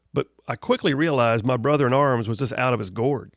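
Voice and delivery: ordinary talk, nothing marked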